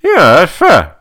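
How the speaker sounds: high, slow, loud mock mumble; garbled high-pitched mom voice; mumbling mom. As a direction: imitate a mom talking through a face mask. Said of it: "she's kind of mumbling".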